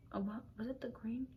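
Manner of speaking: whispered to self. Real, not acted. Whispering to self, recalling the fridge. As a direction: murmur it unvoiced, searching memory. Trying to remember food.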